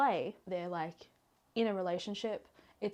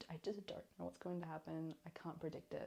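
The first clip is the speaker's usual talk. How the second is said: usual speaking voice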